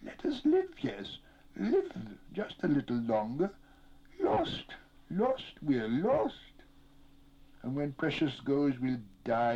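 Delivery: high, wailing, drawn-out plea; wretched high keening with whispered breaks; weeping, whimpering creature. A narrator's reading. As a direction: whine and beg pitifully, breaking into whispers. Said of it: "he wept".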